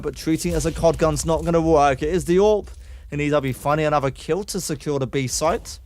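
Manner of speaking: fast, lively esports commentary; excited caster calling a round; play-by-play casting. Acted. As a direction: call the action as it happens. Calling the Dak fight.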